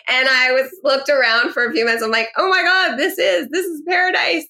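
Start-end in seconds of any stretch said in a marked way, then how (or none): 2.4-4.5 high-pitched retold gush of wonder; awed excitement. Shopper recalling her first look around the store, awed. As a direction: gush as if stunned by what you see.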